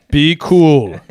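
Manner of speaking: calm them down, Lincoln-style; mock Lincoln, settling. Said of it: "You think Lincoln said that".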